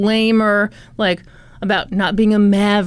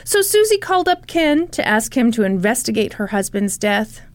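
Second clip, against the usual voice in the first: normal talking voice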